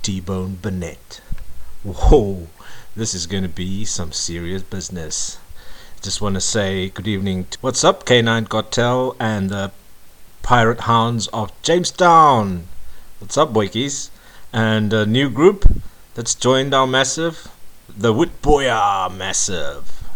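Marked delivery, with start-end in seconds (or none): 1.8-2.5 loud hyped DJ exclamation; excited whoa. Acted. DJ exclaims before big song. 7.6-20.0 loud pumped DJ shout-out; big up the crews; hyped shout-outs. Acted.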